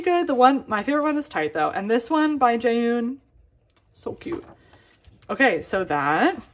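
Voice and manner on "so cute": soft breathy whisper of delight; gushing whisper over the card; whispered so cute. Real, not acted. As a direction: whisper it to yourself. Looking at the Jaehoon card.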